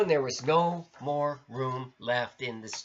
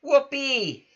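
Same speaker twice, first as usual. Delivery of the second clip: very high, louder whoop; squeaked whoopee; 12 semitones higher, 6 dB louder than usual. Acted. Pip delighted in the mess, very high louder whoop. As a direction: whoop with glee like a little mouse. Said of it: "Pip squeaked".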